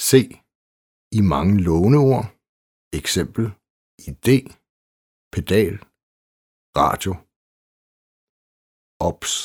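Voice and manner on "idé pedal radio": idé, pedal, radio with a hard, stopped D. Shown as a demonstration. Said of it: "I låneord udtales D hårdt". (hårdt D i idé, pedal og radio)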